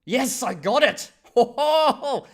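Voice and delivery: loud, slow victorious cheer with a big laugh; triumphant shout. Real, not acted. Cheering at guessing the Spanish word, loud and high. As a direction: crow over getting it right.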